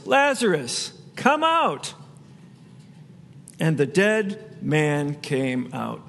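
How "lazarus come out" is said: high ringing call of Lazarus, come out; loud call. A narrator's reading. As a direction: call him out of the tomb, pitched up high. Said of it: "called out in a loud voice".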